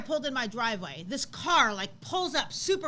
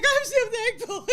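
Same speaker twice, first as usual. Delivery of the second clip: higher, louder, slow, breaking into a cry; tearful thanks; 12 semitones higher, 10 dB louder than usual. Retold or imitated. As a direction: thank her through tears and laughter. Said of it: "she's crying and she's freaking out".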